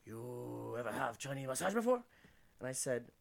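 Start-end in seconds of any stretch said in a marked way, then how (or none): 0.1-2.0 lightly voiced Chinese accent, lower; a hint of a Chinese masseuse. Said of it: "She go".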